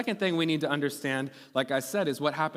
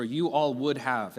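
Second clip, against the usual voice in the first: slow spelled-out phrase; slower than usual. Shown as a demonstration. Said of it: "It stands for".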